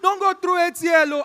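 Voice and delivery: loud, high alarmed "Ah, don't go"; Nigerian friend's loud exclamation. Retold or imitated. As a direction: warn him off ATL with a big Nigerian cry. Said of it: "so they do "Ah".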